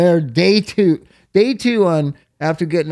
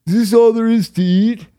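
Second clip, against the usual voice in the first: mock whiny rich kid, higher and louder; bratty kid whining; 6 semitones higher, 4 dB louder than usual. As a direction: imitate a spoiled kid complaining about lunch. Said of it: "he goes".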